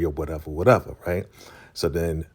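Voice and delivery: normal talking voice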